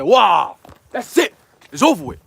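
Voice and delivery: loud, triumphant exclamation; excited outburst. Real, not acted. Son hearing his mom confess faith, loud and triumphant. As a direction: burst out in victory.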